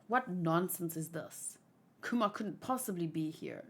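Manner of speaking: low, soft disbelief; understated, low-pitched scorn; dismissive disbelief. Acted. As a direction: dismiss the report quietly, voice dropped. Officer dismisses the report.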